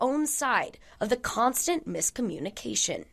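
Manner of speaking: measured, emphatic reporter voice-over; TV news read. A narrator's reading. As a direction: deliver it as an investigative news report. Reporter narrates a news package.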